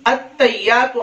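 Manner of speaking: "अत्तहियातु" with the ह swallowed, run together; common mispronunciation. Shown as a demonstration. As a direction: drop the h sound in "अत्तहियातु". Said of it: "आम तलफ्फुज हमारा ये".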